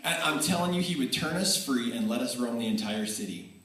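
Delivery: ordinary talk, nothing marked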